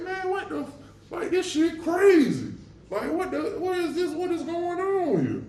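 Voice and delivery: high-pitched panicked disbelief; shocked high voice, words tumbling out; bewildered. Retold or imitated. Being dragged out after sentence.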